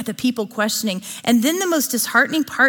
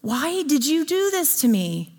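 mock cry to God; 5 semitones higher than usual. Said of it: "scream at him and say".

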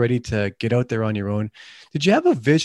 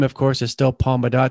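ordinary talk, nothing marked

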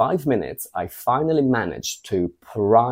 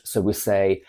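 ordinary talk, nothing marked

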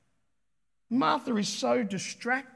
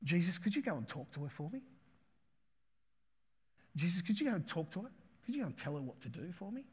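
soft, quick wheedling plea; wheedling request; 3 semitones lower, 10 dB quieter, faster than usual. Acted. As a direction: coax him softly and fast. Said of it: "she then says".

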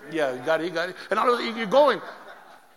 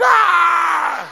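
loud, high, hammed-up shriek of laughter; imitated big laugh; 11 semitones higher, 14 dB louder than usual. Wife laughing at her husband's fall, imitated shrieking laugh. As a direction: mimic the wife with one long, loud shrieking cackle. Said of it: "she came out laughing".

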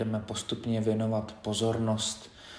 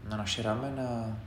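softer, measured body-scan guidance with long pauses; quiet, unhurried meditation leader; guided meditation; 9 dB quieter than usual. Acted. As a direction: guide them gently through the body, leaving space between phrases.

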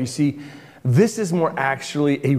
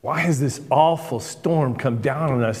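usual speaking voice

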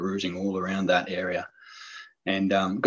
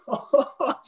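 high, giddy laugh of delight; excited laugh. Real, not acted. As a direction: laugh out pure joy. Thrilled laugh after finding deer.

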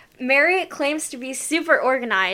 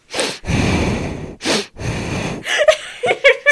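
loud breathing imitation. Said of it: "she'll just go".

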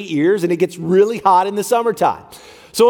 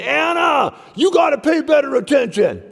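a mock frustrated telling-off, pitched higher; retold frustration; 6 semitones higher than usual. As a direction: scold your daughter, half-hammed. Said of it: "but I was frustrated".

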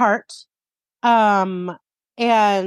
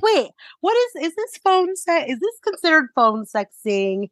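high, slow, baffled voice; confused; 7 semitones higher than usual. Retold or imitated. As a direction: act puzzled and unsure. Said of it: "I'll be like".

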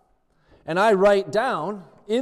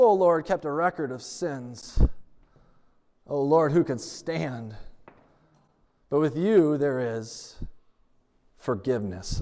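measured scripture reading; scripture recitation; 5 semitones lower than usual. Acted. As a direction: read the verse reverently.

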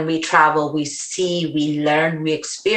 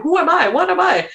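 exasperated questioning; 12 semitones higher than usual. Real, not acted. Rhetorical identity questions.

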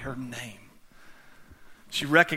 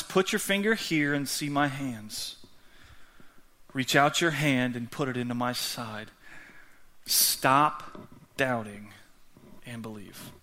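softer, lower reading with weighty pauses; solemn scripture reading; 4 semitones lower, 6 dB quieter than usual. Acted. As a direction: read it gently and low, slowing toward the end. Reads Jesus's words to Thomas solemnly.

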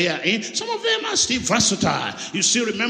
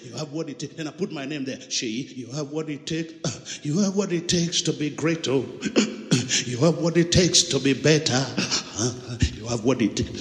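imitating recording; 6 semitones lower than usual. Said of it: "that voice recording".

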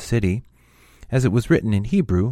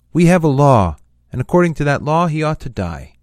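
loud, raised accusing voice; forceful retort; 6 semitones higher, 8 dB louder than usual. A narrator's reading. Accusers answer Pilate about the law, forceful retort. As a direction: insist on it, high and loud.

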